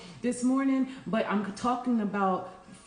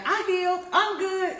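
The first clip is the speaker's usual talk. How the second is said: high, louder, breezy mock confidence; mock self-assurance; 10 semitones higher, 4 dB louder than usual. Healer mocking her own shortcut thinking, breezy mock confidence. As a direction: brush it off like you're already fixed.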